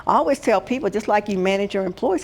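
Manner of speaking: usual speaking voice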